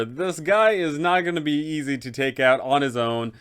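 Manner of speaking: ordinary talk, nothing marked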